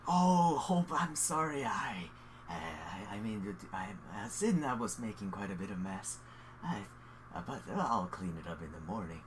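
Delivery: halting, sheepish character voice; apologetic flustered host. Acted. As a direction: fumble through an apology, trailing away. Game master voices the apologetic host.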